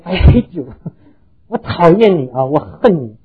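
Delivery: put-on a child yelling I hate you into the valley, loud; boy's yell. A frustrated boy shouting into the empty valley, loud mock yell. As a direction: shout it out angrily, half-laughing. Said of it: "他就在那边喊了一声说".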